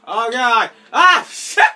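very loud, high cry of alarm; real panicked shout. Real, not acted. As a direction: yell out, caught off guard. Game starts, gets hit at once.

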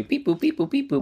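high, soft robotic beeps; playful high-pitched typing noises; computer beeping. Acted. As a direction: beep and boop like a little computer searching. Mimes googling the new neighbors.

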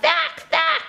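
high squawky jackdaw "Thack, thack"; loud bird call. Acted. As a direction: caw it out like a jackdaw. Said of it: "Jack made a very loud".

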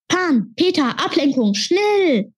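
hoher, eiliger Zuruf an die Freunde; drängender Ruf. A narrator's reading. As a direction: ruf sie drängend zusammen. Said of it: "rief Sam". (Sam ruft drängend nach Ablenkung)

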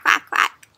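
breathy whispered quacks; whispered duck quack. Shown as a demonstration. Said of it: "Let's make a duck sound".

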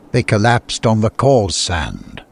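usual speaking voice

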